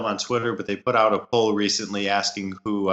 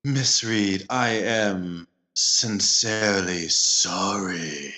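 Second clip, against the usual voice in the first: creepy apology; much slower than usual. Pretend. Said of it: "That was creepy".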